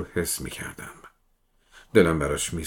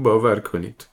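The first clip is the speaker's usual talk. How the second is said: a touch louder, begging "باور کنید"; distraught pleading; 3 semitones higher, 5 dB louder than usual. Acted. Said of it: "با پریشونی به التماس افتاد".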